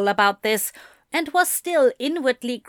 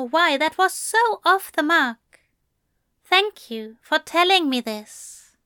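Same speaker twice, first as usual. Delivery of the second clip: higher, polite apologetic angel; gentle angel voice; 5 semitones higher than usual. A narrator's reading.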